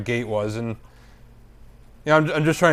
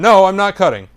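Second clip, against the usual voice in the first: higher, louder mock denial; retold protest; 6 semitones higher, 6 dB louder than usual. Accused passenger to woman in line, protesting. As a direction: push back on the accusation. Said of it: "I'm like".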